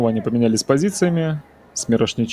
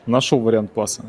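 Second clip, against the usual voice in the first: usual speaking voice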